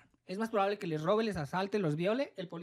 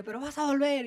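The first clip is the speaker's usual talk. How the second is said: threat through laughter; 6 semitones higher, 5 dB louder than usual. Retold or imitated. Angry cop threatening as she laughs.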